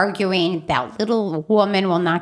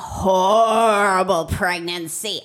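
cursing grandma; 3 semitones lower than usual. Retold or imitated. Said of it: "she put the Italian curse on me".

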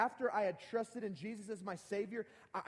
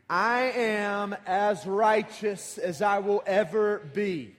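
slow, louder chanted recitation, leading the crowd; loud group recitation; 13 dB louder, much slower than usual. Shown as a demonstration. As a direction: call it out slowly and loudly with the congregation. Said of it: "let's try that again".

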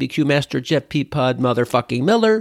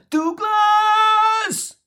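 Colonel Klink style yell. Retold or imitated. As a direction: shriek the name like an angry colonel. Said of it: "he yelled".

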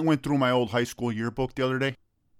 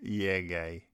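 dull buddy voice. Acted. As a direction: drone it like a bored pal. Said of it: "a normal conversation between me and one of my friends".

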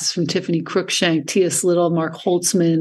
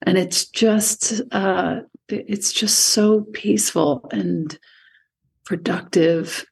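usual speaking voice

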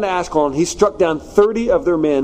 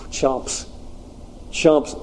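breathy, soft scoff; dismissive muttered chumps; scornful chumps; 2 semitones lower, 6 dB quieter than usual. Real, not acted. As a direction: sigh it out with contempt. Mocking the town's answer.

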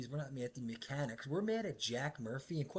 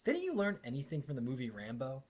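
ordinary talk

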